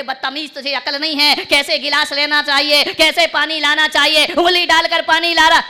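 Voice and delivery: increasingly loud mock rebuke; scolding child. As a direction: tell the child off harshly.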